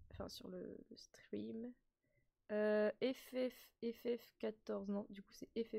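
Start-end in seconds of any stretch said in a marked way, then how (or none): 0.1-1.7 whispered correction under the breath; whispered aside. Real, not acted. Streamer correcting herself, whispered aside. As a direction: murmur it to yourself.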